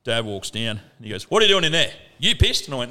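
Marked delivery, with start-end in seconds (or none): 1.3-2.6 louder, higher imitation of dad demanding answers; mock interrogation from an accusing father; confronting dad. Retells dad confronting him at crash.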